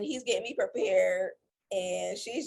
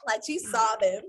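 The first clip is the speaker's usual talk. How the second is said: ordinary talk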